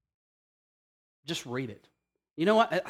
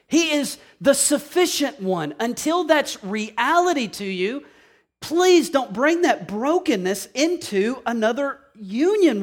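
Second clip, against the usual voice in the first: high, loud, impassioned preaching; preaching at full force; 7 semitones higher, 5 dB louder than usual. Acted. Pastor urging husbands in the pews, preaching at full force. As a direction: drive it home to the congregation, high and loud. Passionate sermon to congregation.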